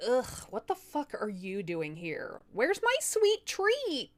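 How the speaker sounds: slow, high put-on demon voice; a cranky spirit wanting her treat; grumpy demoness. Demoness grumbling at the wife who knocked, slow and high.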